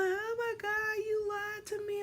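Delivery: mock crying kid; tearful kid. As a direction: wail it at mom. Crying at mom.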